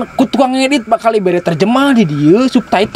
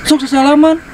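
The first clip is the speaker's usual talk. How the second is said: urging handshake; 7 semitones higher than usual. Real, not acted. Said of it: "sia teh sasalaman".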